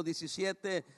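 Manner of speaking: normal talking voice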